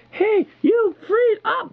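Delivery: very high, slow mock yell of a thug; hammed-up squeaky yell at the cop; cartoonish high thug voice. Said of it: "the guy's like".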